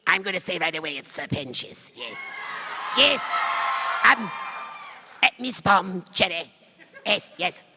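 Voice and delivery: ordinary talk, nothing marked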